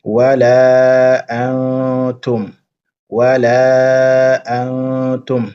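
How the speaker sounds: slow, drawn-out chanted recitation; Quranic recitation. Shown as a demonstration. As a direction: recite it slowly, stretching the vowels. Teacher recites Quran verse slowly.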